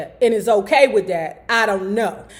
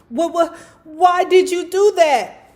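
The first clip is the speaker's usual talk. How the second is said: gasping shock; 7 semitones higher than usual. Acted. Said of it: "Jack asked, gasping for breath".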